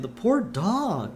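high, slow, mournful lament; pitying crowd member. Acted. Man in the crowd mourning a puppy, slow and pitying. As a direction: draw it out with pity. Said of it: "a man in the crowd saying".

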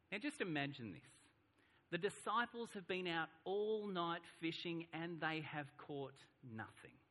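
ordinary talk

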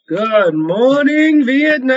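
high, loud, very slow radio holler; drawn-out announcer shout. Acted. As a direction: stretch it out like a wake-up call on air. Radio-show catchphrase called out.

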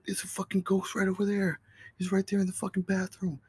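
high, urgent scared pleading; frantic insisting. Retold or imitated. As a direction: convince mom there's a ghost. Insisting to mom about the ghost.

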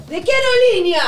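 retelling her flirty question to the pilot; mock eager flirt. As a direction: ham up the keen flirting.

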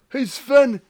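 high-pitched excited call to an officemate; acted delighted shout-over; calling a colleague over. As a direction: call out high, tickled by what you've found.

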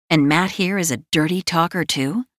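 ordinary delivery

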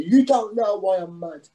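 a man shouting that no one understands his anger, imitated; angry shouter. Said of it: "if somebody shouts at me".

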